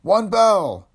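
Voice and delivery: high, loud shout through the door. Acted. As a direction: bellow the call to wake a sleeper. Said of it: "and shouted".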